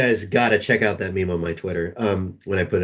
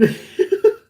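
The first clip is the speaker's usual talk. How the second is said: high, airless wheeze of laughter; breathless laugh with no voice; wheezing laugh. Real, not acted. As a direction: silent wheezing giggle. Laughing hard.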